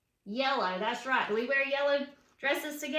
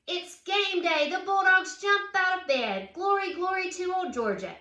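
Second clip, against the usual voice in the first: higher and louder, game-day thrill; excited; 6 semitones higher, 5 dB louder than usual. A narrator's reading. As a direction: wake up bursting for game day.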